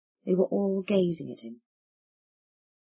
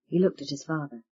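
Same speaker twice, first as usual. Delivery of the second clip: ordinary delivery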